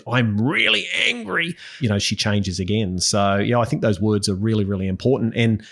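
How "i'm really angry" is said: higher, slower mock voice of an upset little girl; a hint of a cross child; imitating angry daughter. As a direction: play his daughter declaring her anger, slowly and higher. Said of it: "she says".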